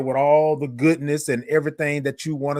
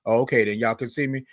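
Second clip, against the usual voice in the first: usual speaking voice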